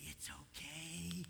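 soft, drawn-out comforting coo; soothing baby talk. Acted. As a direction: coo gently to a fallen toddler, stretching the words. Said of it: "you turn into an idiot".

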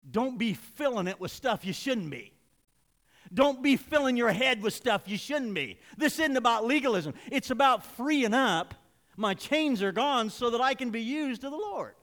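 loud, high, emphatic preaching. Real, not acted. Preacher urging the congregation to free up, preaching forcefully.

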